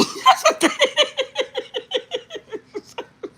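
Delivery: wheezing squealing laugh; long high laughing fit. Real, not acted. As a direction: lose it completely.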